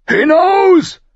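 loud, very high shout; angry royal command. A narrator's reading. King ordering Sir Barristan out, furious shout. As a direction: throw him out with one shouted word. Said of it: "sagte der König mit kalter Stimme".

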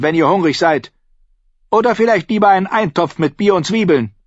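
high, bossy landlady voice; brusque innkeeper. Acted. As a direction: lay down the menu, high and forceful.

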